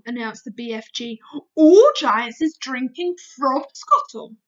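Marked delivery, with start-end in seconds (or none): 1.5-4.4 slow, loud friendly giant; big friendly giant, proud; booming giant. Acted.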